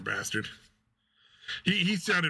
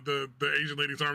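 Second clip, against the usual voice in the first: normal talking voice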